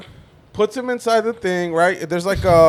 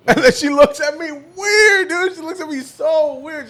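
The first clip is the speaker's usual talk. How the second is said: laughing while talking; 9 semitones higher, 3 dB louder, slower than usual. Real, not acted.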